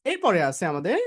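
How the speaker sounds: ordinary talk, nothing marked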